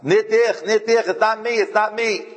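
frantic denial in Yiddish; desperate protest. Retold or imitated. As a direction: scream out your innocence. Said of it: "was screaming out".

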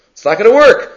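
"work" punched up with a rise; emphatic repeat. Shown as a demonstration. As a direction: hammer the point home.

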